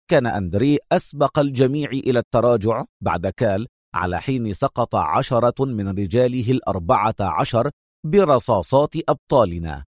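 usual speaking voice

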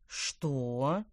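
low, drawn-out "what", rising; astonished question. A narrator's reading. Father hears of intruder, low astonished question. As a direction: ask in deep disbelief. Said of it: "От удивления господин Брецель".

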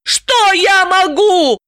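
drawn-out slow wail, voice high. A narrator's reading. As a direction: stretch it out in anguish. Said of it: "взвыла Далила". (взвыла)

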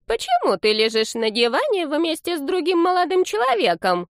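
very high granny voice; high, questioning grandmother; inquisitive old lady. A narrator's reading. Grandma questions grandson on the sofa, high old-lady voice. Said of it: "поинтересовалась старушка в шляпке".

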